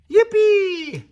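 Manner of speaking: high, loud squeal of delight; excited cheer. Acted. Pirates cheering their party invitation, high and loud. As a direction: cheer like a thrilled child.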